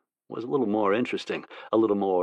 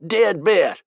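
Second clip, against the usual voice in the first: high, loud excited holler; 10 semitones higher, 7 dB louder than usual. A narrator's reading. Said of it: "he hollered".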